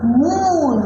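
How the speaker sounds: "मून" with a loud, long oo; "मून", vowel stretched out loudly; long oo sound. Shown as a demonstration. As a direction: hold the oo in "मून". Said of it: "ये है long sound ऊ".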